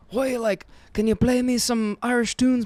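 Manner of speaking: a half-hearted Irish lilt, rushed; quick stab at an Irish accent; a rushed, barely there Irish voice; weak Irish accent. Retold or imitated. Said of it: "Is that an Irish accent?".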